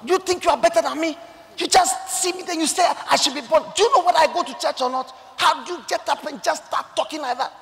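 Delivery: high, loud, quick angry tirade; imitated shouting. Businessman shouting at the preacher for telling him to be born again. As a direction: rattle off an affronted man's rant, high and loud. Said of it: "He started shouting".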